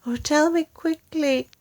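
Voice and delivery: high urgent plea; pleading. Acted. Wife presses husband for the cure, high pleading. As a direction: hurry him, high and anxious. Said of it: "she cried".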